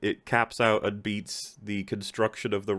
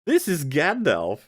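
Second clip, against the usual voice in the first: mock grand announcement; 7 semitones higher, 6 dB louder, slower than usual. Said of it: "this is them being like".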